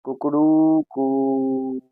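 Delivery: slow, drawn-out imitation of a rooster's crow. Shown as a demonstration. Teacher showing pupils a rooster's call, drawn out. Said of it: "मुर्गा है वो किस प्रकार से बाग देता है".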